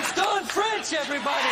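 loud, high, laughing intro; excited announcement. Real, not acted. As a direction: announce the star guest with glee. Host announcing star guest.

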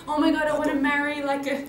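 high excited gush with a laugh; gushing American girl. Retold or imitated. American girl gushing about marrying a Korean. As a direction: swoon like a starstruck fan. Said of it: "American girls say".

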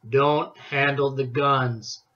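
slow, low warning voice; gruff shopkeeper. A narrator's reading. Clyde's gruff shop warning.

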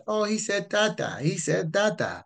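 high-pitched excited cooing; delighted parent. Acted. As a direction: gush like a parent over baby's first word. Delighted parent hearing baby's first word.

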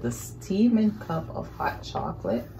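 usual speaking voice

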